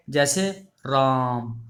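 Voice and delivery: राम with the आ held very long and slow; prolonged calling vowel. Shown as a demonstration. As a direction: draw out राम as if calling someone. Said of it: "किसी को पुकारने में".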